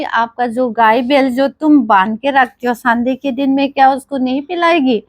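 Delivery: ordinary delivery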